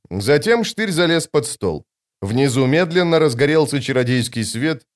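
usual speaking voice

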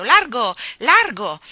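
loud, commanding shout. A narrator's reading. Lion clears a way through the crowd, shouting loud. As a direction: bellow to clear a path.

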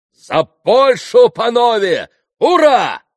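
very high, full shout; shouted toast. A narrator's reading. Mitya raising his glass to Poland, shouting. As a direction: bellow the toast and cheer. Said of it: "прокричал Митя".

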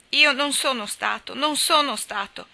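sobbing. A narrator's reading. Nat, accused of theft, sobs his denial to papà Baer. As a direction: cry the words out, slowing on the repeat. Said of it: "singhiozzò Nat".

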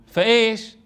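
ordinary delivery

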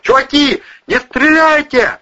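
high, loud plea yelled out; piercing yell. A narrator's reading. Man begging armed pals to hold fire, yelling piercingly. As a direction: scream out to stop the shooting. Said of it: "пронзительно завопил голос Планшетова".

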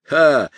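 loud, high mocking laugh; evil chuckle. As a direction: bark out a nasty ha. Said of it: "Джефф злобно засмеялся".

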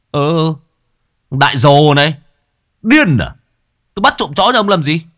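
high, loud insolent retort; cheeky high-pitched denial; mocking denial. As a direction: sneer it back at him.

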